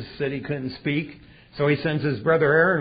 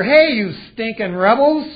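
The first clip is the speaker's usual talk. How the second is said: Moses yelling louder at the rebels; furious call-out; angry yell; 6 semitones higher, 8 dB louder than usual. Retold or imitated. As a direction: bark it out at the crowd. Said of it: "He goes out there".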